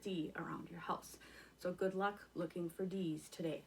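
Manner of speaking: soft secret whisper. Real, not acted. Whispered closing wish.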